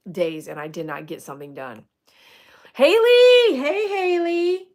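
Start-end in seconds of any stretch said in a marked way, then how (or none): 2.7-4.7 high, loud drawn-out holler; calling out. Real, not acted. Streamer hails a viewer, calling out high and loud. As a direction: sing out the name to hail her.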